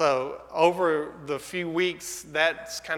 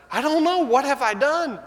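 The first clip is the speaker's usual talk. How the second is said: baffled; 11 semitones higher, 6 dB louder than usual. Retold or imitated. Husband's baffled reply to wife.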